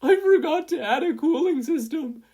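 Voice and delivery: high, loud wailing sob voice; blubbering. A narrator's reading. Izuku wails to Mei through tears, loud blubbering. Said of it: "Izuku blubbered".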